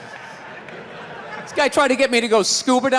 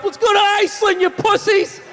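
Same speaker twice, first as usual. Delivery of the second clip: mock taunt; 10 semitones higher than usual. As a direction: jeer at the swimmers like a bully.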